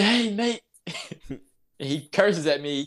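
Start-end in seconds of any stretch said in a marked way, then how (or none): 0.0-0.6 high, loud hail of hey mate; mock Aussie call. Australian stranger hails him from the beach, high and loud. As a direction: call out like a cheery Aussie local.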